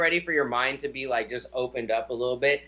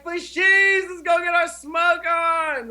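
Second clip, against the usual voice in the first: much higher, louder, slow exaggerated American bro call; hammed-up American stoner; 14 semitones higher, 4 dB louder, slower than usual. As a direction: oversell the American accent like a cartoon stoner shouting to the girls. Hamming up his American accent.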